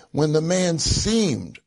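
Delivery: "seemed" stretched out and leaned on; slow the line down to land hard on "seemed"; emphatic, drawn-out "seemed"; stress on seemed. Real, not acted. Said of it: "I emphasize the word seemed".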